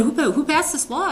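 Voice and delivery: retold outburst of a king demanding the culprit; outraged king. King outraged at threat to Esther.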